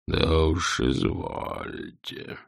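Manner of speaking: very slow, low dragon. A narrator's reading. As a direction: grant it slowly and coldly.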